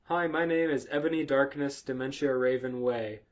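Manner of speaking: normal talking voice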